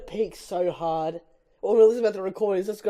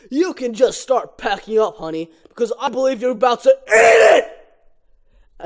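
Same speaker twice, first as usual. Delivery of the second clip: gloat, then bellow the end; gloating taunt; 5 semitones higher, 8 dB louder than usual. Acted. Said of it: "Ken, overly confident".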